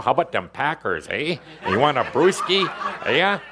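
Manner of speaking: hammed-up Wisconsin good old boy; Wisconsin accent. Host mocks her teenage self from Wisconsin, Wisconsin accent. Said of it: "I talked like this".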